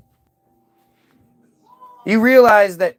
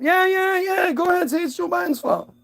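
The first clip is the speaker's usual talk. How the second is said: much higher, mock-delighted yell of permission, then fading softer; mock eager approval; 10 semitones higher, 5 dB quieter than usual. Mimicking the authorities to viewers, a hammed-up high eager yes. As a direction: play the gatekeeper gushing a yes.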